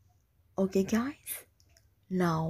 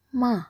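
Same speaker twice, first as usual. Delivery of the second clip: "ma" short, high and loud, dropping sharply; falling tone; 4 semitones higher, 5 dB louder than usual. Shown as a demonstration. Teacher's third tone example, "ma" falling. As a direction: punch "ma" downward. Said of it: "The third tone is a falling tone".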